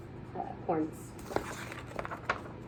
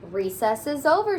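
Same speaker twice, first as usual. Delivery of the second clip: higher, louder call; teacher yelling; 6 semitones higher, 4 dB louder than usual. Acted. Teacher calling the class in from recess, loud. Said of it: "she yells".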